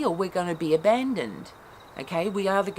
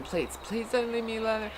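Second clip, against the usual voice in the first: put-on higher, small childlike pleading; childlike plea; 6 semitones higher, 4 dB quieter than usual. Inner child clinging to a partner, childlike whimpering plea. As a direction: plead like a four-year-old afraid of being left.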